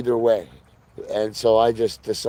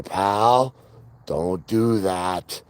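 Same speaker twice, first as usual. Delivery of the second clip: stern dog-scolding tone; 3 semitones lower, much slower than usual. Retold or imitated. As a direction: lower and slow, a firm warning to the dog. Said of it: "I just have to change my tone of voice with Pal".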